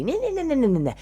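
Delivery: very high babbling mimicry; mock superficial babble. Speaker showing how her energy felt, mocking high babble. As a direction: parody empty chatter, starting high and falling. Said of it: "That's how it felt to me, this energy".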